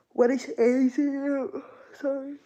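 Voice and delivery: real yawn distorting the words; talking through a yawn. Real, not acted. Streamer asking chat about A Z, real yawning. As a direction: let a yawn take over as you speak. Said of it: "Sorry for the yawning".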